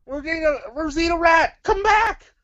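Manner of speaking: high, loud pleading call. Acted. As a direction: yell after someone leaving. Calling after leaving puppet.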